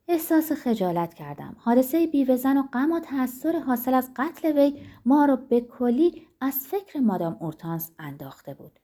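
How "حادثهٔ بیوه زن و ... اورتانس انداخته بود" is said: plain reading voice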